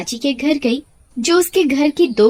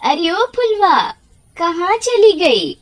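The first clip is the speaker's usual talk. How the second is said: slow, higher, drawn-out call for her daughter; anxious mother calling, higher and slow; 8 semitones higher, 3 dB louder, slower than usual. Acted. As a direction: call across the house for the missing girl. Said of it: "उसे बहुत पुकारती है".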